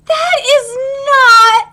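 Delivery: slow, high, drawn-out objection; appalled disbelief. Real, not acted. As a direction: stretch each word out in dismay. Dismayed by character's drawn eyes.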